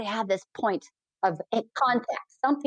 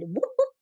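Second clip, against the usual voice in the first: imitated whoop. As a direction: imitate his wordless whooping. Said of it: "this verbal whoop that he did".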